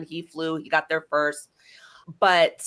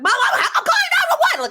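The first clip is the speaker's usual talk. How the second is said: fast, high, loud shout with a nervous laugh; frantic shout; 4 dB louder, faster than usual. Retold or imitated. Daughter shouting to mom in a panic, fast and high. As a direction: blurt it out frantic, half laughing.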